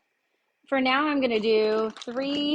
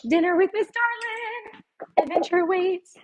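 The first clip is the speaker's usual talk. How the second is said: mock show announcer; 8 semitones higher, 4 dB louder than usual. Cook joking over her mishap, mock announcer. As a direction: announce it like a jokey show title.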